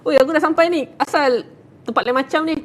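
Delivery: mock annoyed call. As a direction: call out, irritated at the odd place. Purple person calls, annoyed.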